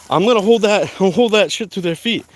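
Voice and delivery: high, loud, laughing through it; fired up, cracking up; heated laugh. Real, not acted. Heated rant breaking into laughter.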